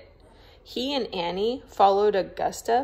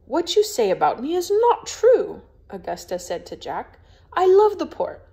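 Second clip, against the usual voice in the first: higher, louder indignant denial; offended protest; 8 semitones higher, 6 dB louder than usual. Acted. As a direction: defend her honour hotly. Augusta protests accusation.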